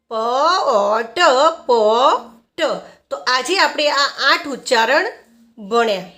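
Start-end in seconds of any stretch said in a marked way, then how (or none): 0.1-2.6 પ, ઓ, ટ drawn out singly, then blended as પોટ; sounding out. Shown as a demonstration. Teacher revising pot at the lesson's end, sounding out. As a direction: stretch each sound of પ, ઓ, ટ. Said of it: "પીનું ઉચ્ચારણ થશે પ".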